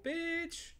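high, teasing yelp of bitch; a friendly high-pitched insult; playful bitch. Shown as a demonstration. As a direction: toss it out with a playful high jab. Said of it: "little bit of playful".

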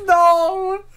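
very high, loud shriek of No; mock horror shriek. Reader reacting to the scene with a shriek.

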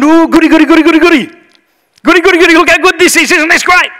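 high, loud, giddy squeal of glee; mock-greedy, sing-out excitement; gleeful delight. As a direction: gush like a kid grabbing treats. Acting out greedy delight at full hands.

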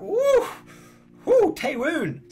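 high squealed woo, stretched slow; excited woo. Real, not acted. Vlogger gushing about the drama's cliffhanger, excited high squeal. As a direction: squeal over the drama hero.

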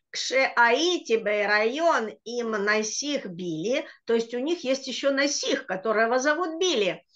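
ordinary talk, nothing marked

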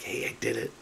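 hushed breathy whisper of triumph; quiet whispered self-congratulation. Retold or imitated. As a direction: whisper it to yourself, pleased. Triumphant self-talk at the spot.

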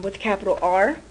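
usual speaking voice

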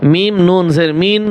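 normal talking voice

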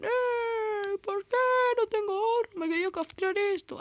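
high, whiny villager voice. Retold or imitated. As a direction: whine like a Minecraft villager. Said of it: "los aldeanos dicen".

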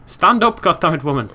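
play-angry shout; exasperated scolding. Pretend. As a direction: bellow at your useless game character. Scolding her own game character.

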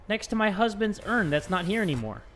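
ordinary talk

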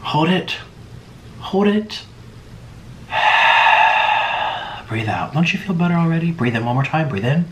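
calm meditation coach voice; guided breathing. Acted. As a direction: lead them gently through each breath. Leads deep breathing.

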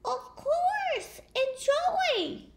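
high, small, polite voice; sweet duckling. Acted. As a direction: play the innocent little duck.

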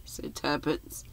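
usual speaking voice

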